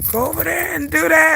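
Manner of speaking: high, strained attempt at yelling; imitated failed yell. Mom ordering her kids around, imitating a voiceless yell. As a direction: push a mock order through a lost voice. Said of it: "couldn't even yell at my kids".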